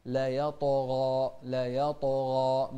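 slow heavy يطغى; heavy ghain. Shown as a demonstration. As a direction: draw out يطغى with a full heavy غ. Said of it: "فنقول".

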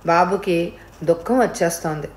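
plain reading voice

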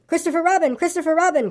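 high-pitched urgent appeal; crying out. A narrator's reading. Piglet greeting his rescuer Christopher Robin, crying out. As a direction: call the name high and eagerly, little extra volume. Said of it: "cried Piglet".

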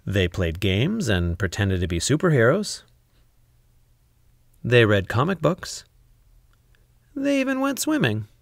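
ordinary delivery, nothing marked

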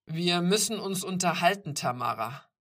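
loud, commanding summons; firm parental authority; stern father. A narrator's reading. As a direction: announce a serious talk, voice dropping on the name.

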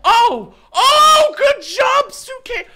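shrieking high, loud praise; excited cheering. Real, not acted. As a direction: cheer them on at the top of your voice. Cheering a teammate's win.

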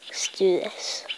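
unvoiced whisper. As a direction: whisper it sneakily. Sneaking into the base.

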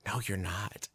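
low, very soft warning; retold hushed, deadpan contradiction; acted quiet objection. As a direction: say it under your breath to stop them.

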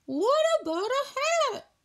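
high quick fish voice; a bright idea from a small fish. Acted. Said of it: "suggested Fish".

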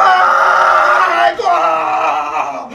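explosive goal yell; screaming goal celebration. Real, not acted. Fan reacting to goal live.

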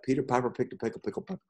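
fast, soft tongue twister; quick tongue twister demo; said quickly. Shown as a demonstration. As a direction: rattle it off fast. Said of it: "could say that quickly".

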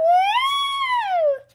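high, long, drawn-out "woo"; long spinning woo. Pretend. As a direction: whoop as you are whirled around. Spun on merry-go-round.

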